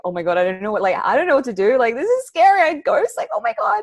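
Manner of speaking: high-pitched, loud panicked squeal; imitating your own freak-out, voice climbing very high; mock panic. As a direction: escalate into a hammed-up frightened squeak. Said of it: "human me was like".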